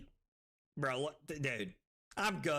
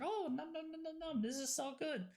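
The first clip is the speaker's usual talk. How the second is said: high, soft munching voice; mock greedy gobbling; 7 semitones higher, 5 dB quieter than usual. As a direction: gobble the words in cartoonish delight. Acts out someone gulping flavored water.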